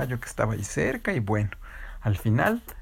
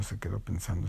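softer, lower musing; quiet thinking; 3 semitones lower, 7 dB quieter than usual. Acted. Storyteller drawing out the pause, softly and low. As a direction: let it drift off as you think.

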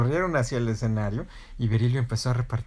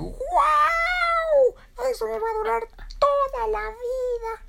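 loud, very high squeaky amazement; Berilio; 4 dB louder, much slower than usual. Acted. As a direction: squeal in awe like a tiny chick. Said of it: "Y Berilio dijo".

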